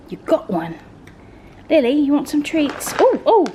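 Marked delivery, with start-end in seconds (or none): 3.0-3.5 high, loud startled cry; real startled oh. Real, not acted. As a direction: yelp as the dog grabs. Dog snatches treat.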